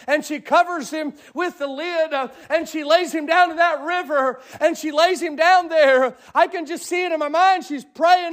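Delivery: high, impassioned sermon delivery; fervent preaching. Acted. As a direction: preach it to the congregation with fire. Preacher retelling at full force.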